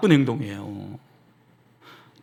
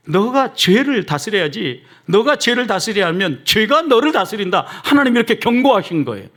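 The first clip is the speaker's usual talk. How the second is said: raised, insistent sermon delivery; higher-pitched, emphatic preacher's warning; forceful preaching; 7 semitones higher, 5 dB louder than usual. Real, not acted. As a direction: drive the warning home from the pulpit. Preacher drives warning home.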